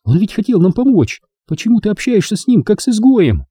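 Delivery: high, pleading protest without shouting; understated high-pitched objection; mild cry of protest. A narrator's reading. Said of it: "кричала Рен".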